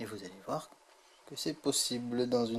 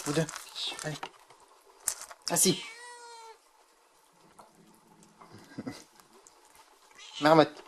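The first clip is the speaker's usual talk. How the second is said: loud cheerful command with a laugh; talking to a cat; 3 semitones higher, 5 dB louder than usual. Real, not acted. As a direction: praise the cats, laughing. Training cats, laughs.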